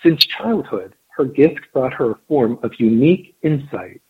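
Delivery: measured storytelling read; story narration. A narrator's reading. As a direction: read it like an audiobook. Reads the story aloud.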